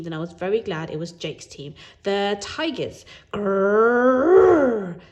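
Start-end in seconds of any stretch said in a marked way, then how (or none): 2.0-3.0 slow, drawn-out announcement; dramatic team call. Acted. Boy announcing his team name slowly. 3.3-5.0 loud high roaring growl; tiger roar. Acted. Boy doing the Tiger Grand Roar, loud and roaring. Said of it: "That's the Tiger Grand Roar".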